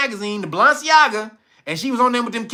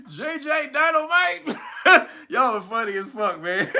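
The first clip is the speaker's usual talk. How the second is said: laughing; 4 semitones higher than usual. Real, not acted. Laughing at chat's jokes.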